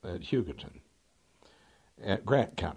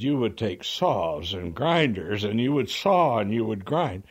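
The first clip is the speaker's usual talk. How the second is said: imitating a lawyer's rising shout; 3 semitones higher, 6 dB louder than usual. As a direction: lean into each verb like an outraged lawyer. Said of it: "he's almost shouting now".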